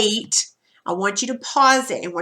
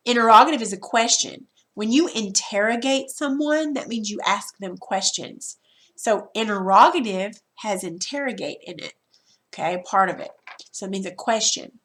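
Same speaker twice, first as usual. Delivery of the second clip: ordinary talk, nothing marked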